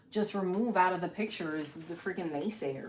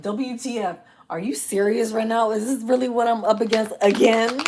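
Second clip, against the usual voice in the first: mock-exasperated, getting louder; exasperated disbelief; 3 semitones higher, 8 dB louder than usual. Reader acting out her own frustration, mock exasperated disbelief. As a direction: build to a loud, incredulous complaint.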